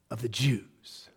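unvoiced hushed whisper; soft awed whisper. Real, not acted. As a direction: drop to a reverent whisper. Hushed emphasis.

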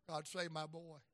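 normal talking voice

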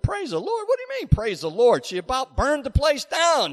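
incredulous. Retold or imitated. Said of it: "I said".

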